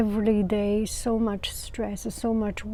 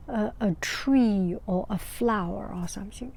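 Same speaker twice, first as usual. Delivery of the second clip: ordinary talk, nothing marked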